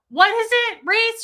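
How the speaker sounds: imitating mom's high, loud yelled question; tipsy yelling. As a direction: yell it shrilly, like a buzzed mother. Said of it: "And she yells it".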